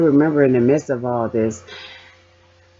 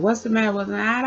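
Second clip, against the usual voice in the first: concerned doctor; 9 semitones higher, faster than usual. Retold or imitated. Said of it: "the doctor comes in".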